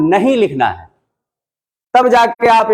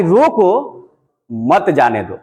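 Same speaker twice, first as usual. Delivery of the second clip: "रोको" rising, short break, "मत जाने दो" falling low; pause after रोको; 3 semitones lower, slower than usual. Shown as a demonstration. Teacher showing how the mark changes meaning, pause after "रोको". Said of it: "अब यही वाक्य कह रहा है कि".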